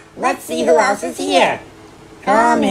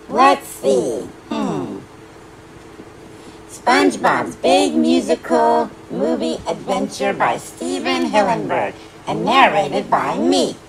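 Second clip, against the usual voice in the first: ordinary talk, nothing marked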